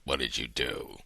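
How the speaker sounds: very low, hushed menace; low, soft, ominous questioning; stern God. Acted. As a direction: drop deep and quiet, like a father demanding answers. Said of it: "to being this guy".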